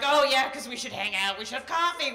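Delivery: loud, bright mock eagerness; sarcastic enthusiasm. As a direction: fake excitement about hanging out.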